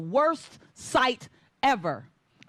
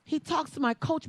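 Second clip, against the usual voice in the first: ordinary delivery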